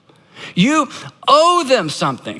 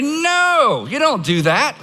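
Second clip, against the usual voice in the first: emphatic refusal; 5 semitones higher, slower than usual. Real, not acted. Rebuking the acted plea.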